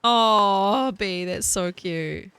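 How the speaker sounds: slow, stretched-out coo with a southern lilt; cooing southern drawl. Pretend. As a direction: drawl it out sweetly. Cooing over chat.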